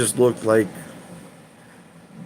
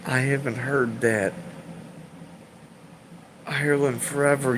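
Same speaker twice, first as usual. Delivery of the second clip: usual speaking voice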